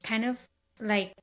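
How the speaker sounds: ordinary delivery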